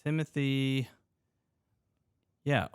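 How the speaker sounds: ordinary delivery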